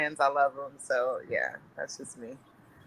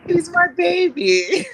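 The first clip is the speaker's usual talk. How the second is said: high, loud, drawn-out mock wail; hammed-up motherly lament; mock mom wail; 8 dB louder, slower than usual. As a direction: play the over-dramatic mother. Acting out emotional mom at son's leaving.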